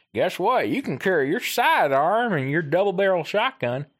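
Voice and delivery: put-on high, strained old man voice; cranky old prospector voice; grumpy old man. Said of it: "what's that old man voice that you're doing".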